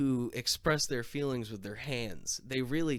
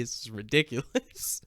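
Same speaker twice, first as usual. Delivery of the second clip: ordinary talk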